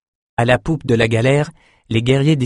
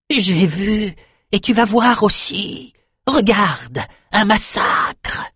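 very high, excited prophet voice; high-pitched visionary, stretching the last word; frenzied shaman; 10 semitones higher, much slower than usual. A narrator's reading. Shaman pulls his king to see the water, frenzied.

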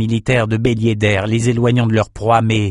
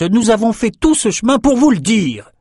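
voix d'émissaire; 11 semitones higher than usual. Acted. Personnage lointain annonçant une nouvelle.

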